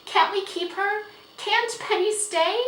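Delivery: high, loud pleading questions; begging. A narrator's reading. Girl begging father to let the horse stay, pleading. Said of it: "begged Felicity".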